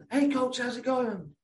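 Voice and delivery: high, slow put-on American accent, kids greeting coach; cheerful American kid greeting in a light American accent. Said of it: "That was my best American accent".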